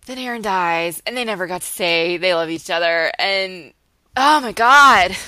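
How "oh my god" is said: exasperated outburst. Real, not acted. Exasperated outburst over TV plot.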